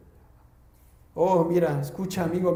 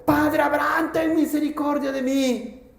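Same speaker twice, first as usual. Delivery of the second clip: a hint of a pleading cry from hell, higher; rich man crying out; 5 semitones higher than usual. Rich man in torment begs Abraham, mild pleading cry. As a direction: beg Father Abraham for mercy. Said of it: "dando voces, dijo".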